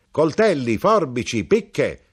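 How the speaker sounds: loud, high street-vendor cry; shouting. A narrator's reading. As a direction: bellow the wares like a knife grinder. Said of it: "gridò l'arrotino". (grido forte e acuto da arrotino ambulante)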